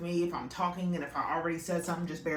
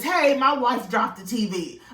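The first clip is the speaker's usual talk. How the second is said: imitating a tattletale husband, loud and raised; mock tattling; 4 semitones higher, 6 dB louder than usual. Husband phoning the tower to blame his wife, mock tattling. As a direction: blurt it out as a mocking retelling.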